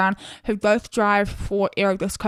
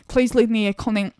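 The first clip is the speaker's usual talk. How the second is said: usual speaking voice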